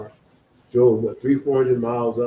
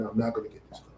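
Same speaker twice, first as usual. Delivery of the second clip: softer, mildly let-down quote; retold disappointment; 2 semitones lower, 10 dB quieter, faster than usual. Teenage boy realising he can't afford the car, mildly disappointed. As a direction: imitate a let-down boy, dropping the volume. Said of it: "the disappointment".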